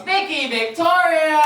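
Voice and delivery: announcer intro. Real, not acted. Host brings the next comic on stage, loud announcer call. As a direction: call the name out to the crowd.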